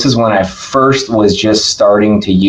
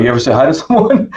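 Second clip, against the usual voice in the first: quick, higher, lightly imitated Brazilian accent; 4 semitones higher, faster than usual. Roberto quizzing the speaker, fast mock Brazilian accent. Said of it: "he's got a thick Brazilian accent".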